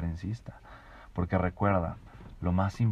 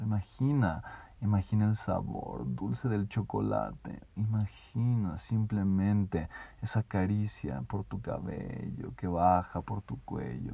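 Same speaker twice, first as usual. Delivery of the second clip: sensual guiding voice; 6 dB quieter than usual. Shown as a demonstration. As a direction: lull them into feeling it. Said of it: "resonador sensorial".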